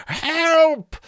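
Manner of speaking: very high screeching skull alarm; shrieked call for the guards; terrible alarm cry. A narrator's reading. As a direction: raise the alarm in long, piercing cries. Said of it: "In a terrible voice, a voice like hail on iron".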